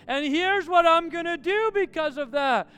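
loud, high declaration as God; booming proclamation. Acted. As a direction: proclaim it forcefully to the grumblers. God announces response to grumblers.

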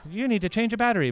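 quick, loud, high robot; high little robot voice. Retold or imitated. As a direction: imitate a little smoke-alarm robot. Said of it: "in this high little robot voice".

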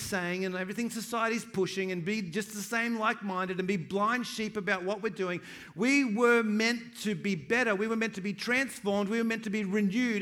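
loud, lifted declaration; preaching at full force. Acted. Pastor rousing his church to do better, full-force preaching. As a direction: proclaim it to the room.

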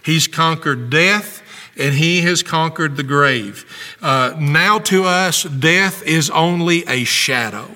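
impassioned preacher driving the point home; fervent preaching. Real, not acted. Pastor telling the congregation Jesus took the hit, fervent preaching. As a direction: preach it with urgent, emphatic conviction.

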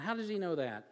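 usual speaking voice

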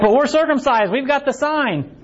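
high-pitched imitated objection; mock protest.

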